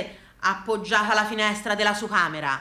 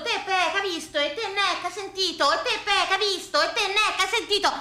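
put-on old couple bickering; 8 semitones higher than usual. Blind wife and deaf husband at the cinema, high squabbling. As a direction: swap questions like a half-blind and half-deaf couple.

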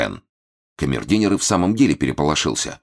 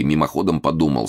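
ordinary delivery

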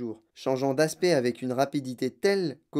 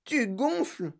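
high, strained exclamation without volume; alarmed cry; 6 semitones higher, 3 dB quieter, slower than usual. A narrator's reading. Mother watching daughter swell up, alarmed cry. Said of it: "hurla madame Beauregard".

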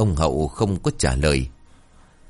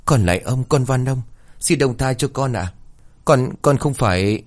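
higher-pitched, understated pleading; lightly voiced frightened servant, begging for mercy; desperate pleading; 5 semitones higher than usual. A narrator's reading. Servant begging the master to spare her, higher pleading voice. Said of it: "tiếng van xin của con Luyến không ngừng vang lên".

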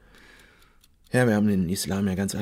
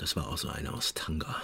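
dark whisper; 4 semitones lower, 10 dB quieter than usual. Acted. Dunkle Anschuldigung, geflüstert.

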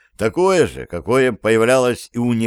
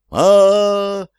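long high panicked "a-a-a" scream; terrified scream; 9 semitones higher, 4 dB louder than usual. Acted. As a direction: shriek in sheer terror. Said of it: "завопили подельники Стива".

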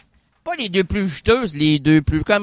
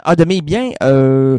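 ordinary talk, nothing marked

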